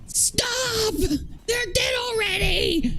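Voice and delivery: high, slow squeal; shrieking laughter. Real, not acted. Cohost shrieking at roast.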